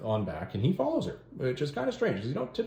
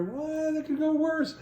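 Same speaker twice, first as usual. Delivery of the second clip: much higher comic squeak; squeaky mock alarm; slower than usual. Conan trapped between troops, squeaking in mock alarm. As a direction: pitch it up like a cartoon panic.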